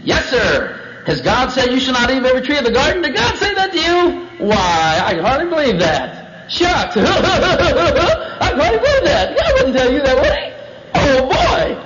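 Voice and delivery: high, incredulous mock laughter; hammed-up serpent planting doubt; scoffing serpent. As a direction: scoff in disbelief, climbing very high. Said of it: "notice his attitude and his approach".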